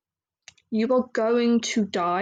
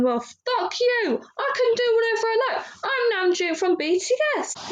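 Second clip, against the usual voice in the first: high, whiny mocking voice; 12 semitones higher than usual. Mocking [name] answering fans, in a high bratty whine. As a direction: sneer it like a whiny brat. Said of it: "he's going".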